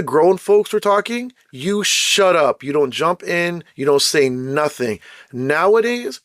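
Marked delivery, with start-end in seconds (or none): none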